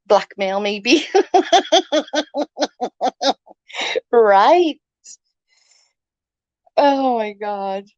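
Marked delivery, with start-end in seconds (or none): 1.1-3.3 long high laugh; high cackle. Real, not acted. Laughing at her own blackmail joke, high cackle. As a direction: burst into giggles.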